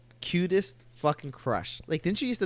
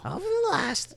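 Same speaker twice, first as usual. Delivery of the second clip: grandma voice. Acted. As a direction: play a sassy old grandma. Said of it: "in the grandma voice".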